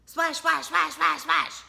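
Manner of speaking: quick excited rhythm; fast splashing. Acted. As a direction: narrator acting fast rowing, excited. Fast rowing sound effect.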